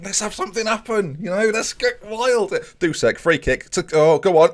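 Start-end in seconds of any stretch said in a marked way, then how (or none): 0.0-2.5 mock hype. Real, not acted. Urging a dull match to liven up. 3.7-4.5 louder, higher cry of go on; will the ball in; urging shot on. Real, not acted. Gamer cheering his free kick, loud and high.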